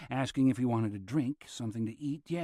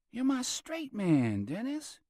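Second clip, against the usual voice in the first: slow, much higher teasing drawl; teasing Robert; 11 semitones higher, slower than usual. A narrator's reading. Robert ribbing Dennis about being his straight man, slow and high. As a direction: stretch it out like a private joke.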